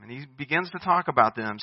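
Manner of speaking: usual speaking voice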